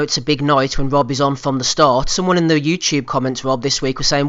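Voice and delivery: ordinary delivery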